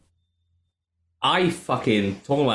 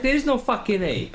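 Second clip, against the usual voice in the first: mock exasperation; 12 semitones higher than usual. Retelling frustrating phone call.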